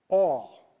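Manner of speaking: "all" punched out loud after a hush; stress on all. Real, not acted. Pastor stressing God supplies all, loud stress on "all". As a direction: hit "all" hard and alone. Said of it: "Do you know what all means?".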